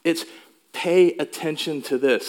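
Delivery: usual speaking voice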